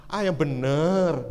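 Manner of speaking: slow, drawn-out sceptical challenge; teasing disbelief. Retold or imitated. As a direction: stretch the words in doubt. Doubting the man's pious claim.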